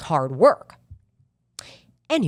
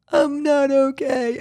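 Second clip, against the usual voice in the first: slow, strained and higher, close to tears; retold tearful reply; 6 semitones higher, 3 dB louder, slower than usual. To her mom on the phone, retelling a teary reply. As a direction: let the voice wobble as if about to cry. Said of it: "And I just started crying".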